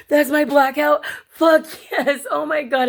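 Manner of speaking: high, loud, thrilled outburst; re-enacted elation. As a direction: celebrate a huge win at the top of your voice. Retelling joy at filled grid.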